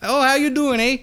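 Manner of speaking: chirpy Wisconsin greeting, hammed-up; Wisconsin accent. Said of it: "The, the Wisconsin accent".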